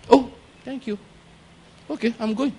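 small, high, soft voice; rebuffed visitor. Acted. Neighbour leaving after being laughed off, meek. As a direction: meekly excuse yourself, high and quiet.

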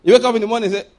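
ordinary delivery

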